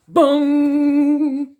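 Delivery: voiced bong like a big clock bell. A narrator's reading. Narrator voicing Big Ben striking, bell bong.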